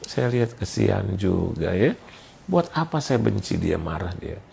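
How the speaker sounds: ordinary delivery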